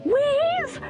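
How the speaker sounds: high, ringing whiz; gleeful high whiz. Acted. Katy whizzing downhill, gleeful high call. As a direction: sing out the speed. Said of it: "went Katy".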